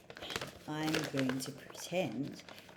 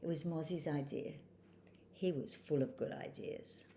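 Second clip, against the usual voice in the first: expressive, lilting picture-book read, higher on dialogue; story narration for children; 4 semitones higher, 5 dB louder than usual. A narrator's reading. Reading a spoonbill story to children, animated storytelling. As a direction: read to little ones with big emphasis on key words.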